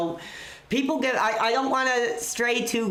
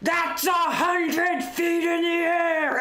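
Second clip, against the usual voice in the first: Vince's commentary call; 8 semitones higher, slower than usual. Retold or imitated. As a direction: belt it out like a ringside announcer. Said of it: "just that call".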